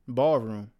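ordinary talk, nothing marked

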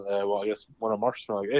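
ordinary talk

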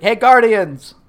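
loud call into the void. Retold or imitated. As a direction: shout out to the unseen guardians. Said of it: "he's just like".

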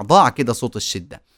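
ordinary talk, nothing marked

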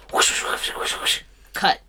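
voiceless rapid swishes of air; whooshing sound effect. Pretend. Host mimicking teams getting cut, whooshing sound effect. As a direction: make sword-slash whooshes with your breath. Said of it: "that was a good sound effect".

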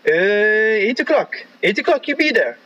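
high-pitched mock French-accented English; imitated French accent. Frenchman setting a time for a petrol run, imitated French accent. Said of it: "Like, that's how I talked".

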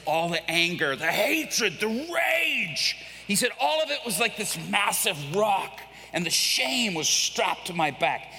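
building to a high, loud cry; impassioned release. Retold or imitated. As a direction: proclaim it with rising force, stretching key words. Convert exclaims over lifted burden.

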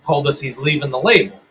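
ordinary delivery

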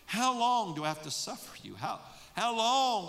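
frustrated. Acted. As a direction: drag out how long in exasperation. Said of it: "you can sense his frustration".

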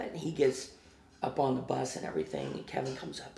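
normal talking voice

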